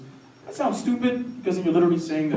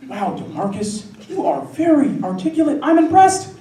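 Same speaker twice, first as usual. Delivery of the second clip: mock patronizing praise, pitched up and building; impressed admirer; 11 semitones higher, 7 dB louder than usual. Someone praising DeMarcus, exaggerated high, impressed voice. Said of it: "You know what does sound familiar?".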